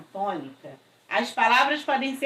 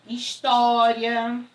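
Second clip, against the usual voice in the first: História drawn out slowly, syllable by syllable; slow syllable demo; 4 dB louder, much slower than usual. Shown as a demonstration. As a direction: spell out História slowly for the class. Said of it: "tônica na antepenúltima sílaba". (História bem devagar, sílaba por sílaba)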